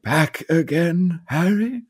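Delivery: slow, lightly voiced old headmaster; a hint of a kindly old wizard, drawn out; Dumbledore impression. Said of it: "Can you do Dumbledore?".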